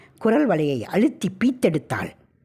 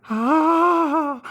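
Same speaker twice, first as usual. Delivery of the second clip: piercing horror scream; terrified scream; 11 semitones higher than usual. Acted. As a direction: scream with the mouth wide open. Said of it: "வாய் கிளிய கத்தினாள்".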